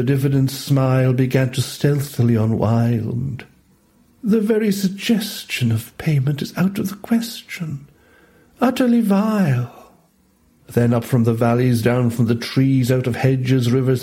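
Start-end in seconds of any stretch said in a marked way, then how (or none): 4.2-9.9 snake character. A narrator's reading.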